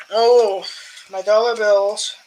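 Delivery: usual speaking voice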